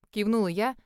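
ordinary delivery, nothing marked

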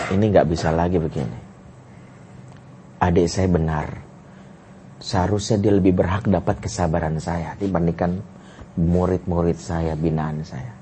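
low, subdued self-admission; reflective inner thought. Retold or imitated. Brother privately admits his sister was right, subdued reflection. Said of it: "akhirnya saya berpikir".

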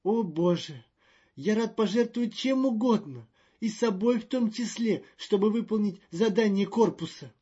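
high, loud and fretful; a jittery official in a panic; nervous diplomat voice. Acted. Magnan's grand pledge.

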